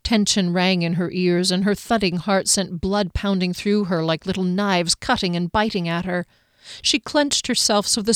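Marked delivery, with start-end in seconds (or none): none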